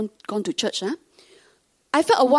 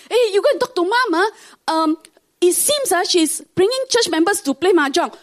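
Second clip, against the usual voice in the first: high, louder worried report; alarmed sister; 8 semitones higher, 5 dB louder than usual. Retold or imitated. Sister phones to report mom bringing church members to mahjong, high and urgent. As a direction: tell on mom, pitched up and urgent.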